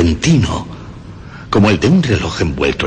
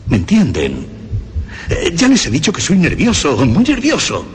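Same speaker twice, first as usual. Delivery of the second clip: much higher, rising into frantic protest; manic insistence; 11 semitones higher than usual. Acted. Madman assures his listeners he is just nervous, frantic and high. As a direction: pitch climbing as he insists he is only nervous.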